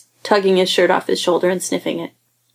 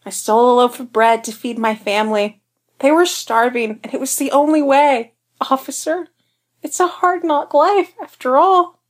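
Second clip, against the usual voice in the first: rising higher, hammed-up plea; mock pleading melodrama; 6 semitones higher than usual. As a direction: ham it up like an orphan. Darcy's mock sob story.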